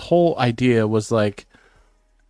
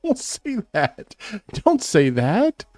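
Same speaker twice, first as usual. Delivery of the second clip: horrified; 12 semitones higher, 3 dB louder, much slower than usual. Real, not acted. Horrified at daughter listening.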